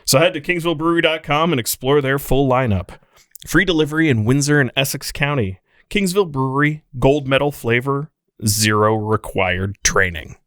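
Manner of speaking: ad read. Acted. Host finishing the sponsor ad, lively ad read. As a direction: sell each beer like a radio spot. Continues ad copy.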